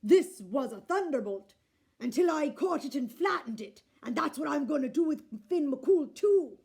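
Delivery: loud booming giant threat; loud menacing giant boast; threatening giant. A narrator's reading. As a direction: brag to scare her, big and loud.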